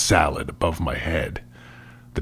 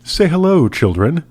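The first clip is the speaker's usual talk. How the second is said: bright, higher coaxing mother; urging; 8 semitones higher than usual. Acted. Mother urging her children to say hello, bright and coaxing. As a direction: coax the kids to greet him. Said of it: "Ellie urged".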